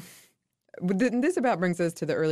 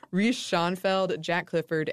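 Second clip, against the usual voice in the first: ordinary talk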